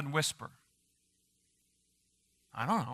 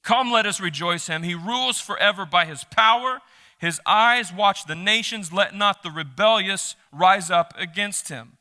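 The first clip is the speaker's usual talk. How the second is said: raised, solemn psalm reading; scripture reading; 3 semitones higher, 4 dB louder than usual. Acted. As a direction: proclaim the verses to the congregation. Reads psalm aloud.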